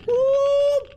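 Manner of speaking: long, high woo; high, drawn-out "wooo"; impressed woo. Real, not acted. Player marvels at how the game looks, impressed high woo.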